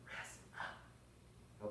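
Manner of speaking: soft unvoiced whisper. Shown as a demonstration. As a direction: breathe it out silently. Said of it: "but I'm whispering the words".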